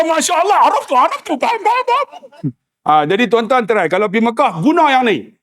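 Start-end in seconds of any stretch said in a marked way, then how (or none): 0.0-2.1 exasperated policeman. Retold or imitated. Policeman voiced high.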